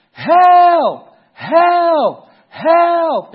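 loud, high, falling calls of help from far off; cries for help. Retold or imitated. Drowning young men calling from the lake, loud desperate cries. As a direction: call for help across the water. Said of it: "we hear faintly, way out in the water".

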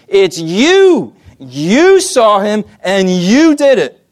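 slow, pointed accusation; bold accusation. Retold or imitated. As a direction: jab each word at the crowd. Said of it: "he's sticking out his finger".

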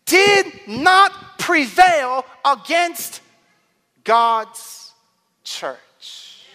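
high, loud and slow proclamation; forceful preaching. Real, not acted. Preacher declaring hell's defeat to the congregation, forceful preaching. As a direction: drive each word out slowly and loudly.

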